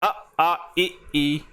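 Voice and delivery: अ, आ, इ, ई spoken only through the mouth; oral vowel demo. Shown as a demonstration. Teacher giving oral vowel examples, careful sounding. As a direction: sound each vowel clearly, no nasal ring. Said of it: "जैसे".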